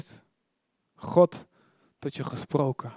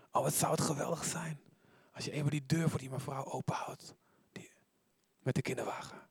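soft whisper, much lower and softer; God's whisper; 4 semitones lower, 10 dB quieter than usual. Shown as a demonstration. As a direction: whisper it like a gentle nudge from God. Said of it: "God hoeft maar te fluisteren". (God fluistert zacht een wens)

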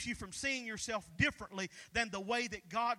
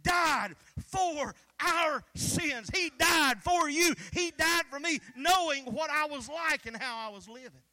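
loud, building preacher cadence; fiery sermon delivery; preaching; 2 semitones higher, 7 dB louder than usual. Acted. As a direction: preach it out to the crowd with rising force. Preaching at full force.

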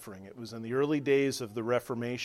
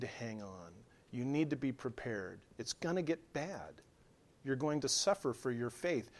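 much softer, earnest hushed warning; quiet warning; 2 semitones lower, 6 dB quieter than usual. Acted. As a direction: lean in and warn them softly. Writer of Hebrews warns readers.

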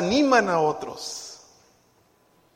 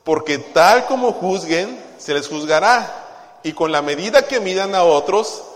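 congregation reading; 3 semitones higher, 10 dB louder than usual. Real, not acted. Pastor leading the church in reading the verse aloud. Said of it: "Todos juntos".

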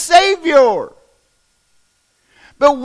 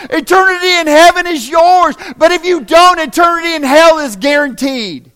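full-force preaching; 3 semitones higher than usual. Real, not acted. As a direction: drive it home to the pews.

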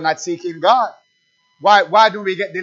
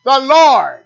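loud call-and-response preaching; fiery preacher calling out; preaching at full force; 5 semitones higher, 6 dB louder than usual. Acted. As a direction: build the rhythm, shout the answer.

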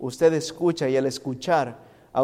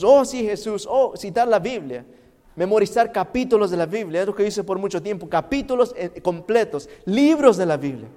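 higher, louder excited run; animated preacher voice; lively preaching; 5 semitones higher, 6 dB louder than usual. Acted. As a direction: pile it on with energy.

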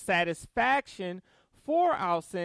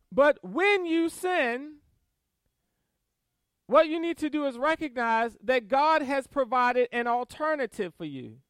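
ordinary talk, nothing marked

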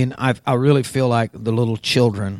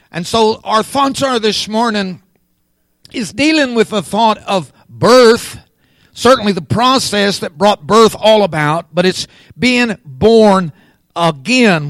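higher, louder preaching voice; emphatic preaching; 7 semitones higher, 6 dB louder than usual. Real, not acted. Preacher giving this morning's thought on birth, emphatic preaching. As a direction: drive the theme home.

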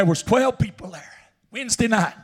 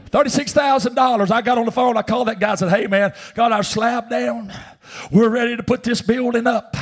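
raised, emphatic preacher's storytelling; preaching; 6 semitones higher, 4 dB louder than usual. Acted. Preacher telling the church about the building money, raised and emphatic. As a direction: preach it out to the congregation.

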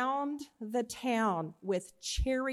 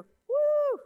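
high whooping cry; 7 dB louder than usual. Acted. Servant's eyes opened to the angel army, whoop. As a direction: whoop of wonder, high and loud.

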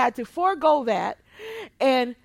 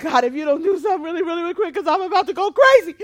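fast, rising and louder; panicked plea; 5 semitones higher, 9 dB louder, much faster than usual. Retold or imitated. Pastor begging God mid-scan, panicked and fast. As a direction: rush it out in a panic.

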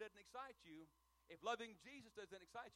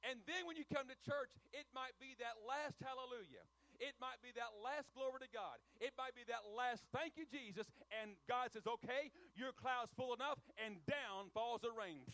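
louder, climbing preacher cadence; fervent preaching; 3 semitones higher, 5 dB louder than usual. Acted. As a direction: lift the crowd toward the climax. Preacher climaxing on rain image.

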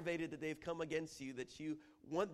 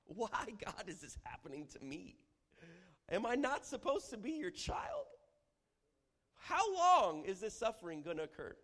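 high, loud pleading questions; anguished why; 6 semitones higher, 6 dB louder than usual. Acted. Preacher acting a believer asking God why. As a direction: demand answers from God. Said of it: "the question comes of why".

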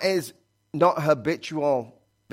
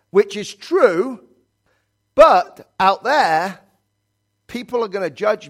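preaching emphasis; 6 semitones higher, 6 dB louder, slower than usual. Acted. Preaching burst.

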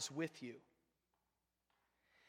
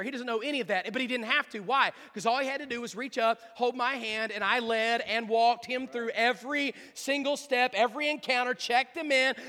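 high, loud, driving sermon build; full-force preaching; 8 semitones higher, 13 dB louder than usual. Real, not acted. Preacher on the boy trusting his father, full-force preaching. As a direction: drive the point home at full volume.

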